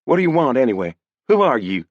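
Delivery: put-on girl challenging a stranger; young woman. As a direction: press him for answers. Girl voiced demanding answers.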